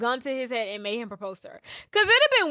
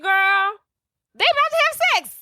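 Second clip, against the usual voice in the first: high, louder, rushed squeal; excited reveal; 11 semitones higher, 5 dB louder than usual. Real, not acted. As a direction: blurt the juicy news at high pitch. Excited reveal to her friend.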